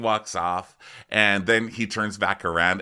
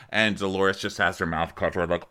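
ordinary talk

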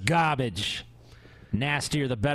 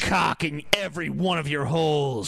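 macho shouting. Pretend. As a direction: yell the ad like a sleazy tough guy. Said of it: "This is how you fucking talk to a woman".

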